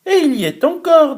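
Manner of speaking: high, fast little cub voice; baby bear, pitched well up; tiny bear's voice. Acted. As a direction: squeak it like a small cub. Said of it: "de sa toute petite voix".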